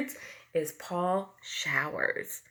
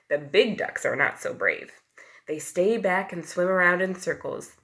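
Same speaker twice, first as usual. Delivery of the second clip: ordinary delivery, nothing marked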